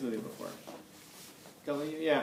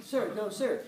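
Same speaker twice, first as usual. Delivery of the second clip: high-pitched imitation of a dutiful private; soldier answering; 8 semitones higher, slower than usual. Private answering his colonel's grilling, dutiful mock-military reply.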